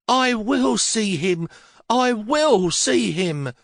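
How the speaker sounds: put-on high, very slow old woman's voice, insisting; old lady refusing to be stopped, slow and high; stubborn old mother. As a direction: lean on every word like a willful old lady.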